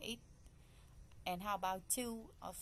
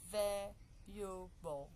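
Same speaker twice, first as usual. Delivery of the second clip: "valuable" broken slowly into three syllables; much slower than usual. Shown as a demonstration. Teacher showing "valuable" has just three syllables, syllable by syllable. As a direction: say "valuable" slowly, a gap after each syllable. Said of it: "Just three".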